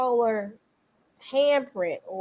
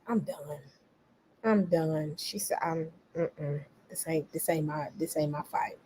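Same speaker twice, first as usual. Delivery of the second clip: softer, resigned brush-off; quiet, done; 5 semitones lower, 11 dB quieter than usual. Retold or imitated. Imitating her quietly walking away from the argument, softer. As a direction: let it go, not worth it. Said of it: "she just like".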